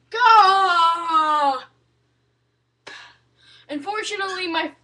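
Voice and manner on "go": loud, higher drawn-out yell; exasperated shout. Real, not acted. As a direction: shout it out long at a stubborn pet. Exasperated with the bird.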